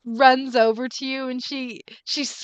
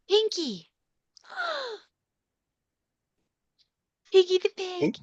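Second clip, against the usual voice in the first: put-on doting girl; 7 semitones higher, 4 dB quieter than usual. Pat doting on her pig.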